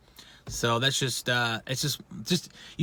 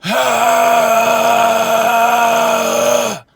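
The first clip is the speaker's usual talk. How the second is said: very long, loud held rasp; throat-clearing scream; 8 semitones higher, 8 dB louder than usual. Shown as a demonstration. Vocal coach showing how to start, sustained throat-clearing scream. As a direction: hold a high harsh exhale until it tails off. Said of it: "just do that".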